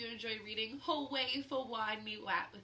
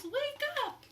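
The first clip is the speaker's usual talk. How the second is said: higher yelling wake up; 4 dB louder than usual. A narrator's reading. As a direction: shout it at the leaf. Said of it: "she's yelling at the leaves".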